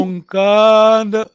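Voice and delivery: Onkanda drawn out slowly and loud for the learner; slow model word. Shown as a demonstration. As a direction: spell out Onkanda slowly, syllable by syllable, loudly. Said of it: "o-, o".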